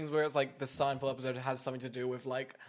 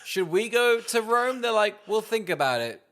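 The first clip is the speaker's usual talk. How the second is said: mock parents; 8 semitones higher, 6 dB louder than usual. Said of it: "They were like".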